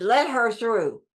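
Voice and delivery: imitated stately call to the crowd; Catherine Kuhlman impression. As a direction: draw it out, imitating a preacher clearing the way. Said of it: "that's the way she talked".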